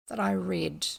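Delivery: ordinary talk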